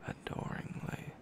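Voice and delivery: ordinary delivery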